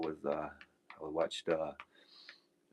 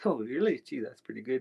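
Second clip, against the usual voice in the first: higher, louder voice for the partner; impressed partner; 7 semitones higher, 6 dB louder than usual. Retold or imitated. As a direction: play the friend's lively interest in retelling. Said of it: "his partner asked him".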